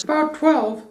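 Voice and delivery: a high, light little bear voice; Pooh voice. A narrator's reading. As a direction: pitch it up as a simple bear. Pooh's voice in a read story.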